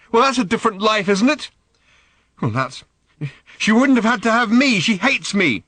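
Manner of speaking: high, furious shouting; bitter angry shout. Acted. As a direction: spit it out in bitter rage. Random shouts back at father.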